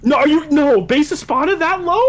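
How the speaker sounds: high, loud disbelief; scandalized fan outburst; incredulous protest. Real, not acted. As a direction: object loudly, voice shooting up. Disputing a friend's ranking.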